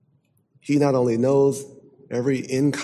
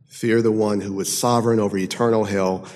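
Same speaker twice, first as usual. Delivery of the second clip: usual speaking voice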